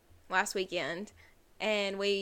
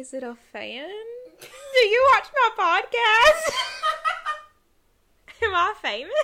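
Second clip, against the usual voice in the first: giggling playful brag; laughing mock vanity; 13 semitones higher, 9 dB louder than usual. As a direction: joke about being famous. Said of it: "And I was like".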